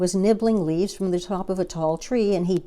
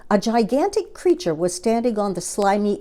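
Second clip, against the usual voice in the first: usual speaking voice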